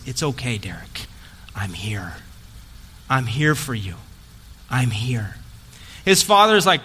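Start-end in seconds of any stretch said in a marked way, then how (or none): 0.1-5.4 low, hushed comfort, dropping almost to a whisper; softly steady him, pausing between phrases; reassuring. Retold or imitated. Father comforting his injured son, low soft reassurance.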